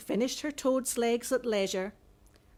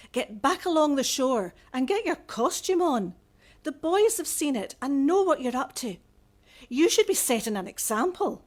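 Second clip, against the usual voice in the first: a louder, raised father's reprimand; stern father scolding; 4 semitones higher, 5 dB louder than usual. Acted. Father ordering his daughter home, stern and raised. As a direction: scold her like a firm Da.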